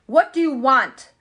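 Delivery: loud booming wizard voice; low rumbling voice. Acted. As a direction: boom it out like a giant. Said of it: "in a low, rumbling voice".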